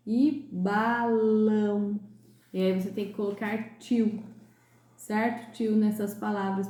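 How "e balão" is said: balão very slow, ão nasal and long; very slow teacher demo of balão. Shown as a demonstration. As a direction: draw out the ão in balão. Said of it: "Com som ã e ão".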